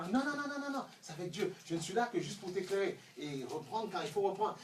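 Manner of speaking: ordinary delivery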